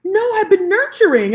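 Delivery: high, loud mock client voice; innocent client reply. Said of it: "And she's like".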